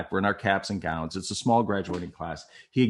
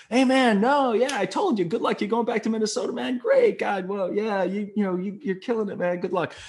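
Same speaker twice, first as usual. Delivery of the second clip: high, hearty babble, imitating him; breezy brush-off; 11 semitones higher than usual. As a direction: keep the cheer pitched up so nobody can get a word in. Drunk friend dodging her plea.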